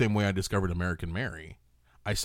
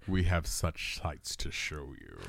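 sinister villain quote; 5 semitones lower, 6 dB quieter, slower than usual. Pretend. Quoting horror villain line.

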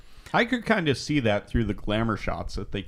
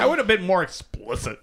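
a lightly voiced, higher mock-prim tone; odd put-on voice; 8 semitones higher than usual. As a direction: slip into a sudden put-on character voice, pitched up. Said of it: "I don't know where that voice is coming from".

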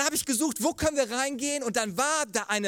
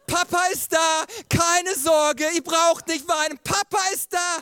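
higher, louder mock-heroic call; dad calling over the ride noise; Papa ist da, loud and high; reassuring call; 7 semitones higher, 5 dB louder than usual. Dad calling to crying kids.

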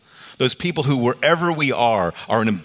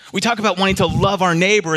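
usual speaking voice

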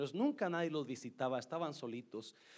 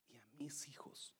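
whispered confession; 3 semitones lower, 16 dB quieter than usual. Retold or imitated. Confessing abandoning her children.

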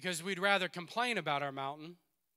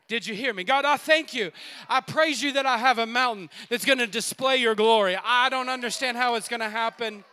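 raised, loud, emphatic thanks to God; fervent prayer; 3 semitones higher, 6 dB louder than usual. Acted. Preacher models thanking God for a mountain, fervent. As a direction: pray it out with force.

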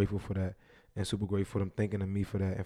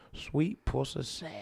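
imitating [name]; 4 semitones higher, much slower than usual. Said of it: "what's [name] do?".

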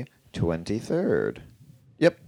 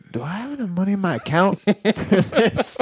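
high, worried inner voice; high anxious voice; 12 semitones higher than usual. Retold or imitated. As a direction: fret high-pitched over the bill. Anxious inner thought quoted.

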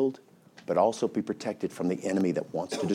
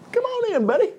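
mock welcome. Inviting the devil into home.

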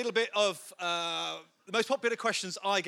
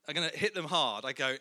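usual speaking voice

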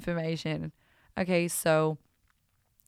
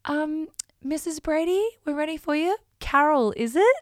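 high, louder sing-song receptionist voice; spa receptionist voice; 11 semitones higher, 6 dB louder than usual. Retold or imitated. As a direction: chirp it like an eager receptionist. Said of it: "so she goes".

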